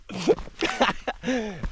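high burst of real laughter; self-mocking laugh. Real, not acted. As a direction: laugh at yourself, high-pitched. Laughs at own crying about heights.

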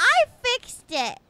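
loud, slow protest; shouting back. Acted. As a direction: yell your defence. Shouted denial in play quarrel.

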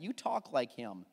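normal talking voice

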